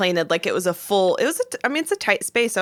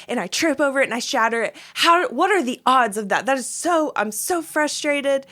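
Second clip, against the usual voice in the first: higher-pitched retold venting; frustrated rant; 4 semitones higher than usual. Wife venting to husband about the broken computer, frustrated rant. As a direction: rant in exasperated disbelief. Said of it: "I'm just so angry".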